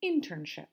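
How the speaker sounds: internship with IN stressed, falling after; first-syllable stress. Shown as a demonstration. Teacher models it for repeating, internship stressed on IN. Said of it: "So we say".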